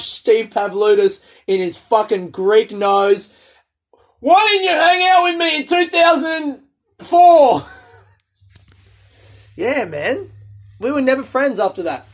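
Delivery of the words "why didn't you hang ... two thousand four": high, loud furious yell; angry shout. Pretend. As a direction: yell the grievance in his face. Imagined confronting Steve at reunion.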